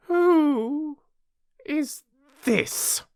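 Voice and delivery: very high, imperious demand with drawn-out words; severe Queen. A narrator's reading. Queen demanding the Knave identify Alice, severe high voice. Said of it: "the Queen said severely".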